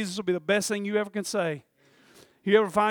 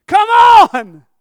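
high, loud desperate urging; urgent shout; 21 dB louder than usual. Retold or imitated. Preacher acting Jairus clearing the way for Jesus, urgent shout. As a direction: yell for him to hurry through the crowd.

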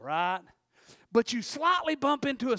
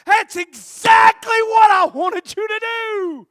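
inner shout; 9 semitones higher, 13 dB louder than usual. Retold or imitated. Teen silently ranting that he wanted her to blame someone. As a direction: shout it in your head, exasperated. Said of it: "thinking in my mind".

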